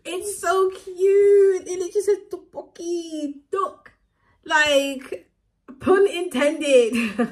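high, loud gushing squeal over something cute; high delighted squeal, laughing through the words; squealing excitement. Real, not acted.

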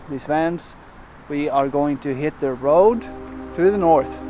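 ordinary talk

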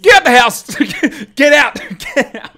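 high, loud gleeful yells; urging the hit out, loud and laughing; excited shouting. Real, not acted. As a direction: cheer the ball out of the park. Cheering a home run.

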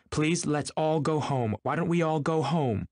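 high, drawn-out pleading; helpless plea. A narrator's reading. Daisy begging everyone to go home, helpless. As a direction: implore them helplessly in a high voice. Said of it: "she interrupted helplessly".

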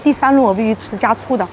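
normal talking voice

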